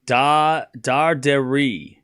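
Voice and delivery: Dardiri slowly broken up as dar-deh-ree; syllable by syllable. Shown as a demonstration. Working out a player's name, syllable by syllable. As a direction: sound out Dardiri with a false start on DA. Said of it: "like, syllables".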